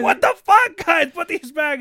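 high, loud angry outburst that settles down on the last words; angry shout. Retold or imitated. Friend finds his light bulbs in the couch, loud angry yell. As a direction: yell at them, then order them.

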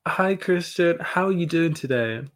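high, bright-pitched hosting voice; podcast host intro. Acted. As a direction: greet the listeners with put-on cheer.

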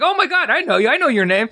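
gushing way up high; starstruck gush. Retold or imitated. Director spotting a famous name pin, high starstruck gush. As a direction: squeal with starstruck recognition.

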